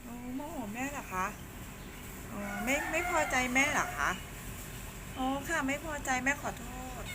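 small high voice for soothing a pet; cutesy small voice. Real, not acted. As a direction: coo apologetically to an upset cat. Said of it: "แม่ทำเสียงเล็กเสียงน้อย".

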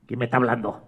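low, soft wondering question; quietly baffled little girl; puzzled, hushed. Acted. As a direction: ask it under your breath, low. María puzzled by unseen voice.

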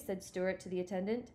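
ordinary delivery, nothing marked

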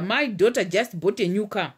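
usual speaking voice

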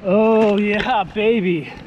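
ordinary delivery

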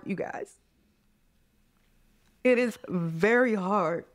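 breathless, then slow and breaking on very hard; choked up. Real, not acted. To her listeners about being drowned out, slow and choked up. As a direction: let the words catch in the throat. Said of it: "I'm getting emotional".

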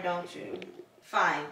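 normal talking voice

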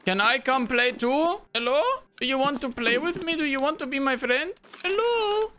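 put-on sing-song eerie child voice; too calm creepy doll. Creepy lonely voice to enemy.

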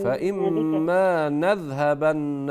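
نذهبن said slowly with the noon given a full open fatha; teacher demo, open the vowel on the noon of نذهبن; slow model of فإما نذهبن with an open noon. Shown as a demonstration. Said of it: "افتحي النون".

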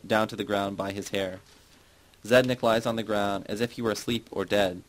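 plain reading voice

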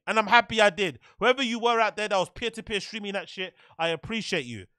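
ordinary talk

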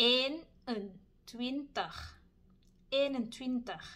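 Eenentwintig slowly, as one linked word; Dutch eenentwintig. Shown as a demonstration. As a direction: link Eenentwintig into a single flow. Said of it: "เราเชื่อมเสียงไปเลย". (ออกเสียง Eenentwintig เชื่อมเป็นคำเดียว)

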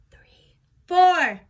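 breathy whispered count. Shown as a demonstration. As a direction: whisper it while squatting. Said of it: "I'm gonna use a whisper voice".

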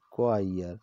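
ordinary delivery

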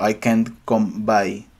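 slow, deliberate read of the phrase; slow model sentence. Shown as a demonstration. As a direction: model it slowly for students. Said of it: "es un poco más avanzado". (frase modelo dicha despacio)

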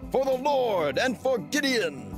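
high, ringing war cry; battle shout. Acted. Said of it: "blow your horns and shout".

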